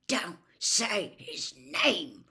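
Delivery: slow hiss through clenched teeth; hissed through gritted teeth. A narrator's reading. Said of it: "Ron hissed through gritted teeth".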